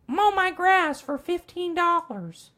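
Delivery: put-on high, slow old lady voice. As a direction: quaver it like a stingy grandma. Neighbor grandma haggling over mowing.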